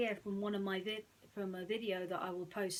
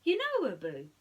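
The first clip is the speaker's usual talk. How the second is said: Aladdin, friendly; 4 semitones higher, 9 dB louder than usual. Acted. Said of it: "he said".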